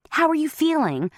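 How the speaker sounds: concerned mother. A narrator's reading. Mom asks with concern.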